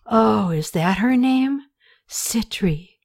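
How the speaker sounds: slow, dreamy, savoring the name; savoring slowly. Acted. Smitten Rene repeats the girl's name to Katie, savoring slowly. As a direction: let the name roll over your tongue. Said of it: "He repeated her name slowly".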